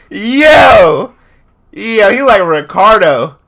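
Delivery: loud high excited Yo, slowing down; excited shout. Real, not acted. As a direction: holler it with delight. Excited reaction.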